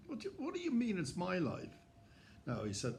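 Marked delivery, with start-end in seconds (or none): 0.1-1.7 pitched up, incredulous question; can't believe what he's hearing; higher, astonished; high disbelief. Retold or imitated. Retold disbelief.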